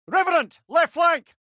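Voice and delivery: loud, high shouted enemy warning; battle callout. Acted. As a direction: call out the threats over the din. Combat callout.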